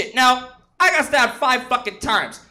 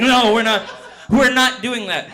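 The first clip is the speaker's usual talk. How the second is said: usual speaking voice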